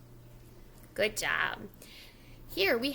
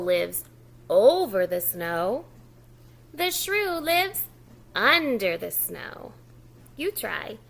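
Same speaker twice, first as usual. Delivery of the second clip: lively louder story reading; reading to children; 5 dB louder than usual. Acted. Teacher reading the book aloud to young children. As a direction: read the snow story aloud to a preschool audience.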